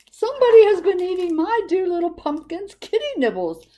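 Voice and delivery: high, loud fussy mother voice; doting mom fretting about her cat, high and loud; high mommy voice. A narrator's reading. Mommy person voiced in story.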